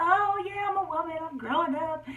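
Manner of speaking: put-on high, thrilled kid's voice; giddy excitement. Girl thrilled about growing up, high and giddy. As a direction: squeal it like a proud kid.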